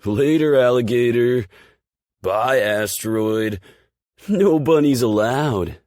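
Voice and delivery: high, loud, slow taunting goodbyes; jeering shouts. Acted. The guys shouting mock goodbyes at Aspen. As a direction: holler it after her. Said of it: "Several shout and wave bye".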